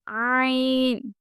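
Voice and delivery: عین drawn out very slowly from the throat; a long guttural عین; throaty ain. Shown as a demonstration. Teacher demonstrating a slow throaty عین. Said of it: "عین حلق سے آنا چاہیے".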